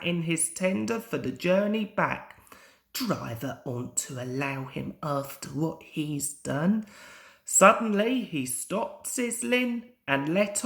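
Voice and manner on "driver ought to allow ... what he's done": softer, lower aside to himself; sulky mutter. A narrator's reading. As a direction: mutter it resentfully. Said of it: "He sizzled crossly".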